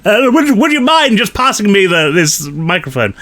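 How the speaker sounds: high-pitched, exaggerated posh English call; very posh accent. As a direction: call across the room in an absurdly posh accent. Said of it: "I got posher and posher and posher".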